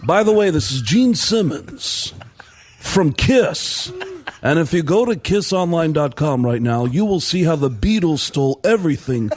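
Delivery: smug salesman impression with deliberate phrasing. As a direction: put on a rock star's pitchman voice. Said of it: "interrupt him as [name]".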